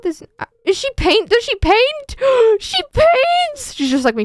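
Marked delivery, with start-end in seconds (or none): 0.6-3.7 rising to a high squeal; mounting excitement. Real, not acted. Streamer finds out the character paints, mounting excitement. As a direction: build from amazed question to squealed discovery.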